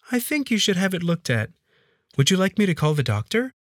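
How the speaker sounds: high-pitched maid's voice, solicitous; concerned maid. Acted. Maid urging her hurt employer to get seen, concerned.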